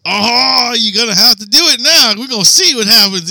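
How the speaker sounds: high, loud gleeful taunting. Retold or imitated. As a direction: egg him on, loud and delighted. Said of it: "he's like".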